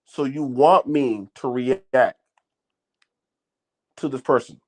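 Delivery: slow, drawn-out incredulous question; deadpan disbelief. Retold or imitated. Answering people who want a reaction out of him, deadpan disbelief. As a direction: spell it out slowly, unimpressed. His mock-slow reply to instigators.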